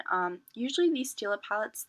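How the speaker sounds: ordinary talk, nothing marked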